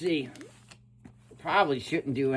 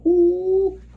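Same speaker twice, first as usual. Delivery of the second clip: high, loud impressed "ooh"; high falsetto ooh of approval; 7 dB louder than usual. Real, not acted. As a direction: coo with delight.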